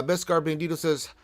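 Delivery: normal talking voice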